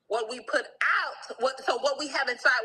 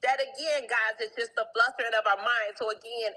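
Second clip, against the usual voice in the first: ordinary delivery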